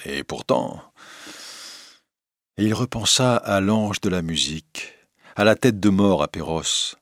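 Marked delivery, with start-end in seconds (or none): 0.1-0.9 low, wistful afterthought; sighing. A narrator's reading. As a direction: let it out with a sigh. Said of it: "avec un soupir".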